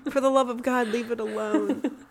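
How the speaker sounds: laughing, fed-up pleading; exasperated plea. Retold or imitated. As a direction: beg them to stop, half laughing.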